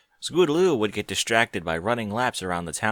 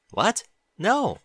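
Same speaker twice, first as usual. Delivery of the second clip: surprised; 12 semitones higher, 5 dB louder than usual. Acted. Spike startled denial.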